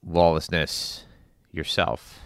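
usual speaking voice

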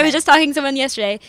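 usual speaking voice

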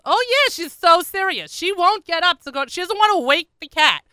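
high, loud, incredulous confirmation; mocking exasperation. Daughter backing up mother's absurd story, loud exasperation. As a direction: hammer each point in disbelief.

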